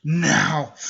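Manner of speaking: loud acted outburst; one loud, angry cry; pissed off. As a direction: burst out in mock fury. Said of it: "Naruto gets pissed off".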